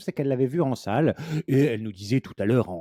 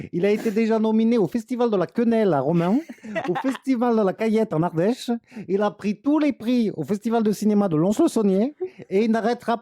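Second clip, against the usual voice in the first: higher, pompous hype for a flop; mock-grandiose announcement; 9 semitones higher than usual. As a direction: oversell it like a trailer voice-over. Ironic grand film announcement. (annonce pompeuse et ironique)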